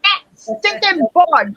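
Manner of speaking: high, drawn-out mock outrage; mock yell of exasperation; retold yelling. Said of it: "What are you yelling at?".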